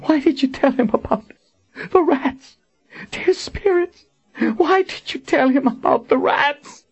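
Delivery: high-pitched, tearful anguish; weeping. A narrator's reading. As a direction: cry it out in torment, pitch way up. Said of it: "Kara wept".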